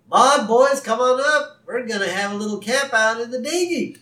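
high, loud cheery dad call; Ned. Retold or imitated. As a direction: call the family over with hammed-up cheer. Ned calls his family aboard.

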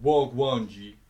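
loud, hard Wagwan G; tough roadman greeting. Shown as a demonstration. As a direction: bark it like a roadman. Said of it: "or you can be like".

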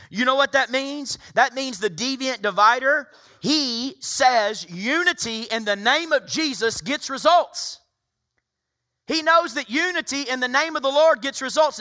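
high, insistent sermon voice; full-force preaching. Real, not acted. Pastor quoting Jesus to the congregation on agreement, full-force preaching.

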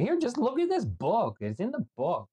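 high, put-on voice of a nagging mother; drunk mother scolding. Mom comes home drunk and scolds her son, high mock nagging.

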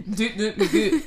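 laughing, goofy, dim-witted doll noises; hammed-up vacant doll; dopey doll voice. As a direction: play the doll as empty-headed. Mocking dumb doll.

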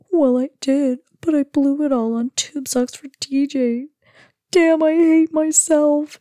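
high, hammed-up mock sobbing; pretend crying. Husband jokes he blew the money on tube socks, pretending to cry. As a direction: wail it as an obviously fake cry. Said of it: "he's, like, pretending to cry".

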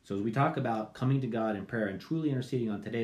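ordinary delivery

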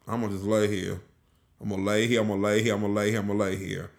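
low, soft, repetitive mumble to self; sleepy refusal. Retold or imitated. Tired traveller to self on Friday night, sleepy refusal. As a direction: settle in quietly, refusing to budge. Retold tired wish to stay in.

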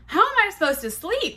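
high, loud indignant whine; acted exasperation, voice jumping way up; exasperated protest. Woman to man leaving her bedless, high exasperated protest.

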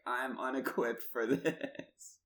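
mild wail of being overwhelmed; mock panic. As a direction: lightly act out being out of your depth. Voicing own panic in French shop.

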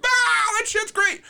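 very high, slow mock whoop of approval; dumb-crowd delight. Crowd loving a stupid enough joke, mock delight. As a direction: imitate a crowd loving something stupid, hammed up. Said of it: "if you just do it stupid enough".

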